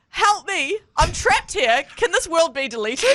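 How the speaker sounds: high-pitched mock distress; trapped game character. Trapped NPC begging player for rescue, high mock plea. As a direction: plead like a helpless game character. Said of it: "one for Guy would be".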